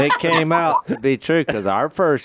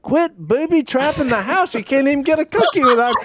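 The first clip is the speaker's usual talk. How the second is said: exasperated; 14 semitones higher than usual. Retold or imitated. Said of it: "And I'm like".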